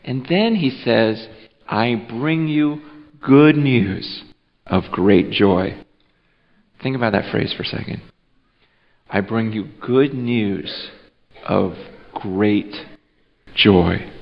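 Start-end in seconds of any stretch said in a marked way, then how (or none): 1.7-5.7 angel's announcement, slow and spaced out; deliberate, lingering proclamation; slow, weighty quote. Acted. Angel's verse quoted slowly for weight. 9.1-14.1 low, hushed, very spaced-out words; slow, savoured quote. Acted. Verse repeated slowly for reflection.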